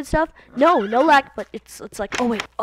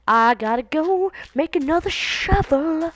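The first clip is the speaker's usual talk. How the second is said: goofy put-on voice; 7 semitones higher, 4 dB louder than usual. Put-on voice while playing.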